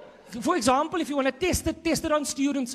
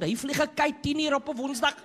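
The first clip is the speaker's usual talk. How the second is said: ordinary talk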